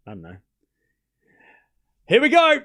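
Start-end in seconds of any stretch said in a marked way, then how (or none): 2.1-2.6 excited. Real, not acted. Match point tension building.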